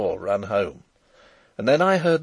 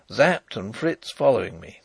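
ordinary delivery, nothing marked

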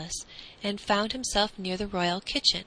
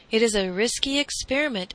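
mild exclamation from the cook; lightly voiced cook, exclaiming; 3 semitones higher, 4 dB louder than usual. A narrator's reading. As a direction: exclaim it with some concern. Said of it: "exclaimed the cook".

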